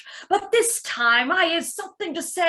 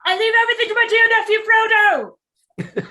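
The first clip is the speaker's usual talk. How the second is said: much higher, louder, rushed; excited blurt; 8 semitones higher, 5 dB louder, much faster than usual. Acted. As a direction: gush it out all at once. Bilbo blurts out the bequest.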